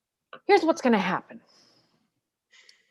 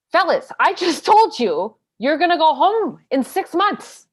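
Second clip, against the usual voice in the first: exasperated; 6 semitones higher, 5 dB louder than usual. Acted. Lawyer exasperated at clients.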